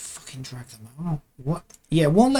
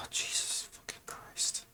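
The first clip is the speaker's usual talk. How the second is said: slow curse under the breath; exasperated whisper; 17 dB quieter, slower than usual. Real, not acted. Animator to self as the arms misbehave, exasperated whisper. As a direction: sigh it out, barely voiced. Sighing curse at stubborn software.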